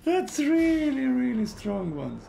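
high, loud alarmed shriek. Real, not acted. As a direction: shriek in alarm, then settle. Overwhelmed by monsters.

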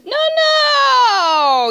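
high, loud cry of dismay; anguished scream. Real, not acted. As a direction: shriek as the character dies. Dying in game.

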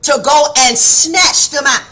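slow, weighty quoted line; God telling her her purpose; God speaking slowly. Retold or imitated. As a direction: draw it out with authority. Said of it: "He said".

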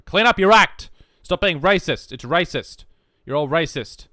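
loud, high hammed-up telling-off; mock scolding shout. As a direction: yell a pretend reprimand. Mock scolding of his audience.

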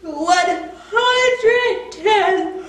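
slow, stretched insistence; drawn-out insistence. Acted. Pigeon stretches the numbers insisting he is awake. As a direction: drag each number out to prove it.

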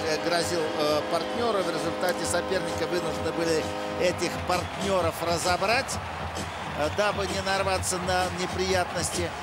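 rising excitement as the puck goes in; excited hockey goal call. Real, not acted. Goal scored on power play.

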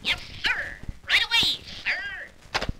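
a fawning hotel manager; eager bellhop. Acted.

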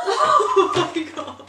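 loud burst of laughter; laughing. Real, not acted. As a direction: crack up at your own joke. Laughing at own pun.

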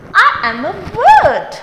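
storybook bird speaking. Acted. First take in bird voice.

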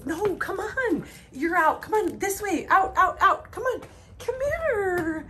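high, sing-song coaxing of the animals; coaxing call. Real, not acted. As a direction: lure them over with a lifted pleading voice.

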